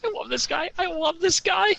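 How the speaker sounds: real excited gushing; delighted. Real, not acted. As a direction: gush with glee. Player delighted by the bluff.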